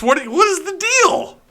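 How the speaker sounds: high, loud what-is-the-deal; exasperated disbelief. Real, not acted. Streamer baffled by broken webcam, high exasperated cry. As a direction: throw up your hands in pitched disbelief.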